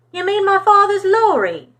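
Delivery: put-on light English accent, puzzled; English accent, puzzled question. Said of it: "in his different accent".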